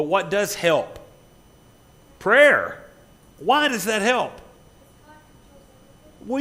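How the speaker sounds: high, loud preacher at full force; impassioned preaching. Real, not acted. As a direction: drive each phrase home, loud and slow.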